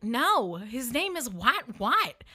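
mildly frustrated family. Retold or imitated. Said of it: "getting frustrated with me".

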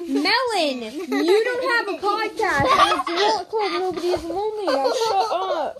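arguing with myself. Acted. Arguing with other self, loud.

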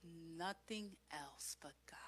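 soft, breathy whisper. Real, not acted. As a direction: breathe it to the congregation. Closing whispered affirmation.

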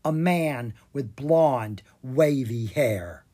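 plain reading voice

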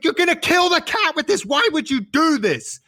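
high-pitched horrified protest; hammed-up panicking child; freaked out. Son accusing mum over the cat food, freaked out. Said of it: "freaked out".

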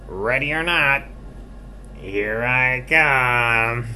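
high, loud, drawn-out seeker's call. Acted. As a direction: sing out the seeker's warning. Seeker calls out in hide and seek.

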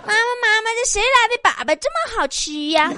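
high, childish put-on voice; little son. Son eating stinky tofu asks his mother, high child voice. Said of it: "他儿子问".

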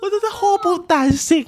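high, loud, half-laughing lament; venting over the broken massage chair; exasperated venting. Real, not acted. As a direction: complain like the universe is against you.

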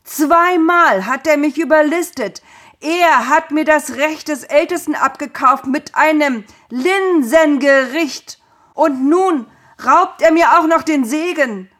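high, loud enraged shouting; furious roar. A narrator's reading. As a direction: rage at the betrayal. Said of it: "brüllte Esau".

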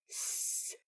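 S as a long hiss of air, no voice; voiceless S sound. Shown as a demonstration. Teacher shows no throat vibration, S hissed without voice. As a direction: breathe out a soft sustained S. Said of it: "là phụ âm vô thanh".

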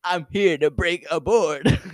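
slow, loud hammed-up declaration; mock karate bravado. Karate student boasting to the class, loud mock bravado. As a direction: announce it like a mock martial-arts hero.

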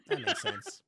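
squeaky mock agreement; high squeaky echo. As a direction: jump way up for a squeaky mock take. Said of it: "echoing the quote".

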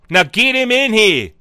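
loud, commanding call to bring him in; hammed-up summons; mock announcer call. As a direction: bark it like a showman. Host calling guest in.

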